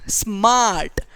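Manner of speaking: स्मार्ट with its one vowel drawn long; sounding out. Shown as a demonstration. Teacher showing one vowel sound, स्मार्ट sounded out slowly. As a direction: stretch the "aa" in स्मार्ट. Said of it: "स्मार्ट को जब आप spell करोगे".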